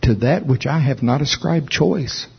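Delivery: usual speaking voice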